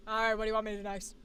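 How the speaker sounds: fast, low, matter-of-fact; snap back to normal. Acted. Stepping back after the take, quick and low. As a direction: drop the act, low and businesslike. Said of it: "I love the transition".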